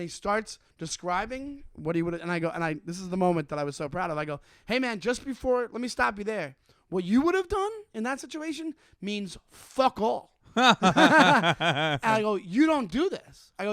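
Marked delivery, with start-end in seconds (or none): 4.7-13.5 firm telling-off. Retold or imitated. Retold telling-off of heckler.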